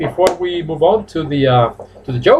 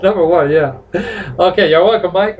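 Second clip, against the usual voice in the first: usual speaking voice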